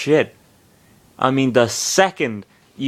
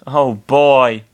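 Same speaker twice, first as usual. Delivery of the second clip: usual speaking voice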